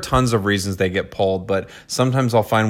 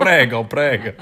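lightly hammed-up Italian accent; mock Italian accent. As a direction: playfully imitate Italian, laugh in it. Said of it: "I would just walk around going".

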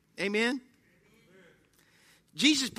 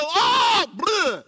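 higher, louder mock cry of disgust; mock outburst; 8 semitones higher, 6 dB louder than usual. Frightened person blurting out instead of praying, high and loud. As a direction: blurt it out like a scared cursing sinner. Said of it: "you might go".

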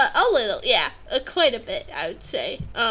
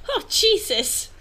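laughing; 8 semitones higher than usual. Real, not acted. Laughing at suicide letter.

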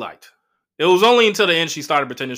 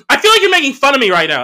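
higher, louder, fast hurt protest; mock hurt girlfriend; 10 semitones higher, 9 dB louder, faster than usual. Imitating girlfriend accusing him of mockery, high and loud. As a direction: whine it like she caught you mocking.

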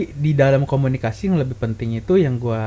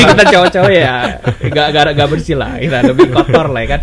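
louder, talking through laughter; laughing; 6 dB louder than usual. Real, not acted. Admitting guys are messy, laughing as he talks. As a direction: joke about messy guys while laughing.

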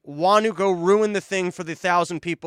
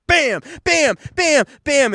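loud high bam bam like clashing cymbals; cymbal clash imitation; 7 semitones higher, 5 dB louder, slower than usual. Mocking Trump as a cymbal monkey, loud imitated cymbal clangs. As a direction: bang out the cymbal crashes with your voice.

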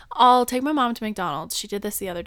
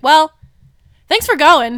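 loud, higher mock-grateful thanks; a sarcastic jab from dad; 6 semitones higher, 13 dB louder than usual. Dad mock-thanking the girls, sarcastic and loud. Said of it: "just the way he said it".

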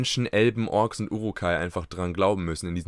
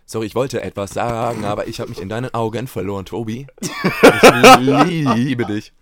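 mock sappy confession; 7 dB louder than usual. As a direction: play it sappy, dragging the end. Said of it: "Und dann einfach recuttet alles".

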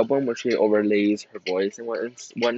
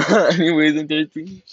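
louder, higher, laughing repeat; giggly and raised; 5 semitones higher, 10 dB louder than usual. Real, not acted. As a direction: laugh as you move on. Laughs while repeating herself.

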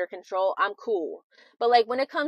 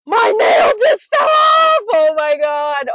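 1 shrieking outrage; 10 semitones higher, 12 dB louder, slower than usual. Real, not acted. Outraged exclamation.

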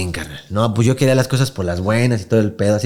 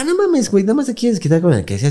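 high scolding quote; 9 semitones higher than usual. Retold or imitated. Same scolding quote retold.

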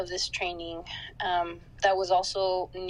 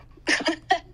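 nervous laugh; 11 semitones higher, 6 dB louder than usual. Real, not acted. Nervous laugh before emotional answer.

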